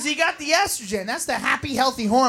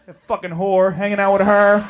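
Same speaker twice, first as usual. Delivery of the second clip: low, surly mock man's voice; grumbling boyfriend; 8 semitones lower than usual. Boyfriend left at home, low resentful grumble. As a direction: grumble it deep in your voice.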